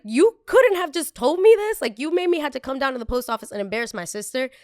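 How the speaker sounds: high, loud incredulous complaint; exasperated confrontation. Retold or imitated. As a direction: confront her, voice shooting way up. Retold confronting the lady, exasperated.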